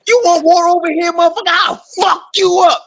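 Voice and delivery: high-pitched hammed-up challenge to a rival nation; mock threat. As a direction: pitch it way up like a cocky tough guy daring them. Threatening foreign enemies in rant.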